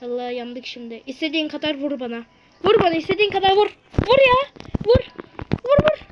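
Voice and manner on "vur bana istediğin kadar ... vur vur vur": loud dare. Real, not acted. Daring friend to hit him.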